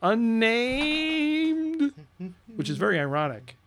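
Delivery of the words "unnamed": high, stretched-out name call; hammed-up name announcement; drawn-out announcer. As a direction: hold the vowel long and high. Repeats name in announcer flourish.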